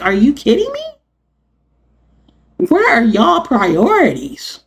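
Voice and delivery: high, slow disbelief; incredulous. Real, not acted. Vlogger ranting at Congress, high slow incredulous. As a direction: stretch it out, can't believe it.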